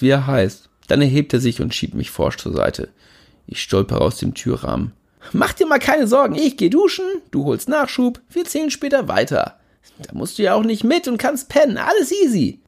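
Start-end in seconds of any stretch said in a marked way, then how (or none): 5.3-12.6 brash party buddy, pitched higher; cocky friend, pushy and carefree; Jimmy Blue voice. Acted. Jimmy reassuring narrator.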